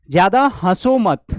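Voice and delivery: loud, slow, firm don't laugh; stern warning. Shown as a demonstration. Teacher acting out a loud stern warning to a laughing student. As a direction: clamp down on someone giggling.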